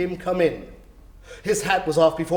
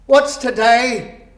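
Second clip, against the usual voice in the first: high, loud, slow call; cried out; 6 semitones higher, 6 dB louder, much slower than usual. A narrator's reading. Scrooge calling down to a boy in the street, loud and slow. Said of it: "cried Scrooge".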